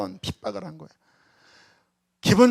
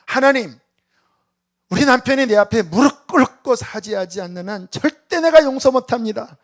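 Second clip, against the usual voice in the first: loud, high, adamant vow to God; the wronged wife declaring she won't forgive; defiant prayer; 5 semitones higher, 8 dB louder than usual. Retold or imitated. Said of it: "하나님 앞에". (높고 크게 단호히 외치는 기도)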